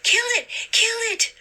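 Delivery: very high, shrill-pitched panicked screaming; frantic cry. A narrator's reading. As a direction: shriek it twice in sheer fright. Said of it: "cried Tracy".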